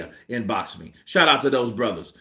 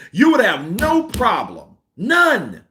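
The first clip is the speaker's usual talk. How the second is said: higher, louder, building insistence; insistent repeat; 8 semitones higher, 4 dB louder, slower than usual. Real, not acted. Vlogger insisting Fury could admit it, loud and high. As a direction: push it up hard the second time. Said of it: "repeats louder higher".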